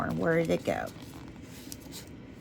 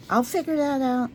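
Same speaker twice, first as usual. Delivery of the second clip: higher, louder and decided; raised-pitch, projected restatement; spoken up; 7 semitones higher, 4 dB louder than usual. Real, not acted. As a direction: say it up brightly for the listeners to hear. Repeats her quiet aside, louder.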